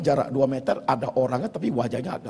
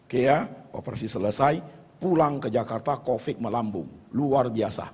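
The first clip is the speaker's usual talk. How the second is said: usual speaking voice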